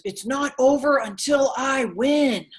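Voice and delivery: Les Brown. Retold or imitated. Speaker quoting her hero Les Brown, slow rallying shout. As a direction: proclaim it slowly like a rally speaker. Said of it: "Les Brown says".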